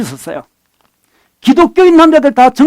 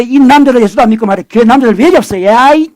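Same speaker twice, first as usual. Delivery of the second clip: ordinary talk, nothing marked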